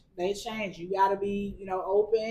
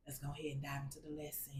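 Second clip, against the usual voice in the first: whispering; 6 semitones lower, 19 dB quieter, much faster than usual. Real, not acted. Whispered lead-in to lesson.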